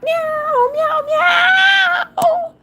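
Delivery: very high sung cat meows. Pretend. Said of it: "You guys need some cat sound effects?".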